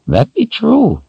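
high raspy crone voice; raspy old woman. A narrator's reading. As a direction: rasp it out like an old witch. Narrator voices Addie.

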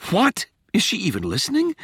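high-pitched, startled disbelief; incredulous. A narrator's reading. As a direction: react in high, rising disbelief. Rachel's startled inner reaction.

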